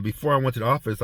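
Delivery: ordinary delivery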